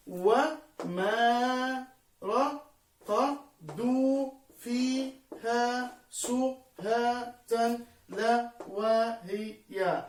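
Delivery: very slow, every syllable separated by a gap; teacher's slow scansion demo. Shown as a demonstration. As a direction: sound out the verse in isolated units, holding the long vowels. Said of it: "لاحظنا عزيزي الطالب كيف أننا وضعنا الحرف المتحرك لوحد".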